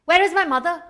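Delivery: high, loud, urgent call. A narrator's reading. Daughter coming home looking for her mother, calling out. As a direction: call out in search of your mother. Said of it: "I heard her calling my name".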